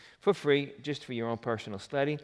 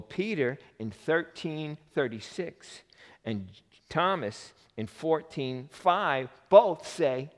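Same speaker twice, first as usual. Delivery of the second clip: normal talking voice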